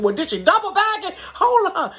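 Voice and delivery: high, bright mock alarm; mock surprise. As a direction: shoot the voice up in comic disbelief.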